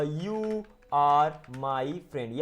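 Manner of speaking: very slow, every word separated. Shown as a demonstration. Teacher answering "what will you say?" for learners, word by word. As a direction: lay out each word for learners to follow.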